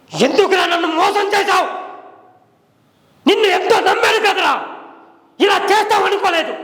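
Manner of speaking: loud, high shouting at a cheat; angry confrontation. Shown as a demonstration. Acting teacher demonstrates furious dialogue to students, shouting. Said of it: "ఇప్పుడు చెప్తా, dialogue, body language".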